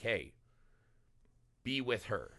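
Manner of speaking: soft, lightly voiced voice in the head; understated, quiet inner prompting; Cole's inner voice. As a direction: murmur it like a thought urging someone on. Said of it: "maybe that was Cole's inner voice".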